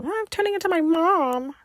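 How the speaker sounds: high, put-on motherly voice; mock mom voice. Telling people about her nails, a mock mom. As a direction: play her own mom sighing. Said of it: "I always go into that tangent".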